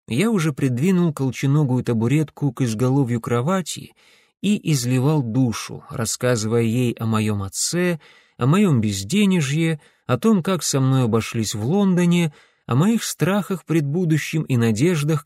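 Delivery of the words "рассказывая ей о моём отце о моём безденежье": plain reading voice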